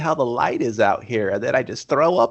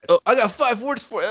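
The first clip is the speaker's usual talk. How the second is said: hammed-up tough guy; 10 semitones higher than usual. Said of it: "And then the guy's like".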